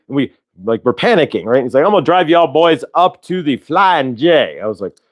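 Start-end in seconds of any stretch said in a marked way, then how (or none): 1.8-4.6 higher-pitched mock Southern lawman; Southern cop. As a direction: play a folksy Southern cop. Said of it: "Bubba cop".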